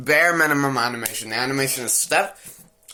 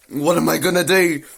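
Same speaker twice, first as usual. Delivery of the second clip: gasp, then a slightly raised panicked question; mock panic; 4 semitones higher, 3 dB louder than usual. Hero hears his wife knocking, mock panic. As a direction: imitate a cornered man's horrified gasp. Retells the cornered hero's panic.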